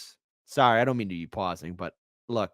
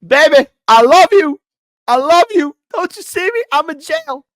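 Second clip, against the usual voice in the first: mock lovesick plea; high, loud hammed-up crooning; 13 dB louder than usual. Mock pleading to Tapper.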